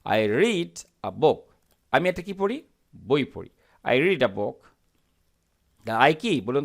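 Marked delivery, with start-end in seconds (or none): 0.1-1.4 very slow, each word spelled out; slow model reading. Shown as a demonstration. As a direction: read it out slowly for learners. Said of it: "তখন আমরা আ বলবো".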